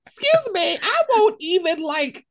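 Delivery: high, loud, offended refusal; mock indignant outburst. She snaps back at a suggestion, hammed-up indignation. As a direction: act out being scandalized, voice up high. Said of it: "I'm like".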